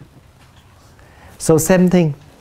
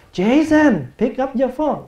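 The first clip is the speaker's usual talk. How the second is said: high, curt call across the house; calling out to brother; 6 semitones higher than usual. Retold or imitated. Brother being called to the phone, curt high call. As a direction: snap it at your brother, higher. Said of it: "you can call your brother".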